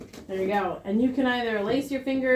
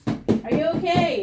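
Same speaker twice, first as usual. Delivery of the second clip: higher, louder and drawn out; loud check; 7 semitones higher, 5 dB louder than usual. Shown as a demonstration. Teacher demonstrates checking for responsiveness on a mannequin, loud. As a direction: call slowly and loudly to an unresponsive person. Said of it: "we're gonna be loud".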